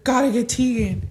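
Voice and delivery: put-on a toddler scrambling to fetch her twin; urgent little voice.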